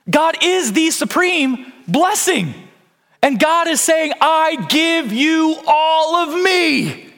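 high, ringing proclamation; impassioned preaching. Real, not acted. Preacher telling the congregation God gives all of himself, exultant proclamation. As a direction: preach it high and full, drawing out the key words.

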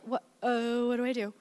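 hushed, helpless mock reaction; imagined bewilderment. Wife imagining husband's reaction to her meltdown, softly bewildered. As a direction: murmur it softly, at a loss.

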